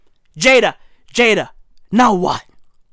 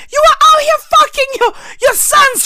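mock wailing. High fast mock wail.

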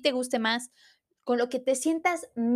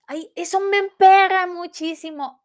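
exasperated; 6 semitones higher, 7 dB louder than usual. Real, not acted. Annoyed about rejected hugs.

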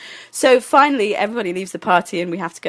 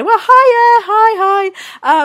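high, loud, stretched-out hello; awkward greeting; 13 semitones higher, 7 dB louder, much slower than usual. Retold or imitated. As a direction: overdo a friendly hello to cover embarrassment. Retold awkward greeting while naked.

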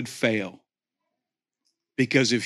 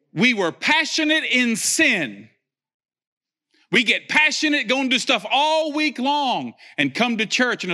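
fiery preaching; 7 semitones higher than usual. Acted. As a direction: preach it with fire, pushing the congregation. Preaching at full force.

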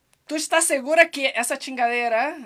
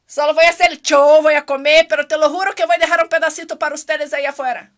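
loud promise; 2 semitones higher, 12 dB louder, faster than usual. Real, not acted. Promising the crew outside loudly.

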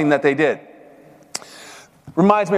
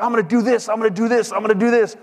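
higher-pitched imitation of eager bragging; mock excited boasting; 4 semitones higher, faster than usual. Young powerlifter boasts online before the meet, mock excited. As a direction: repeat it eagerly, like an overexcited newcomer. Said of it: "gets all excited and talks".

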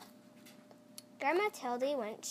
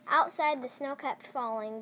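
ordinary delivery

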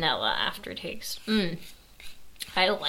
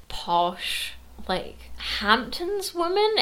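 mock posh Hamptons woman; 9 semitones higher, much slower than usual. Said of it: "trying to do some sort of".

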